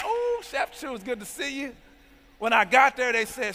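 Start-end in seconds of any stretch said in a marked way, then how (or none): 0.0-1.8 gushing greeting. Retold or imitated. Conference staff greeting him warmly.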